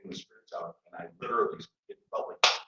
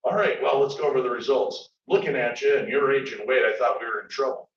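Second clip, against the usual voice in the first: imitating a doctor, louder and higher; lightly voiced doctor, projected and raised; doctor's voice; 5 semitones higher, 10 dB louder than usual. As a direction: announce results like a brisk, hearty doctor. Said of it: "And the doctor comes in".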